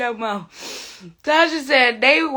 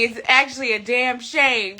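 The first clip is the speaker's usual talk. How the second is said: ordinary talk, nothing marked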